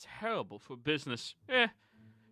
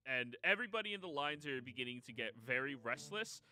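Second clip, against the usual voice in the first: ordinary talk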